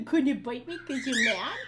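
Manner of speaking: ordinary talk, nothing marked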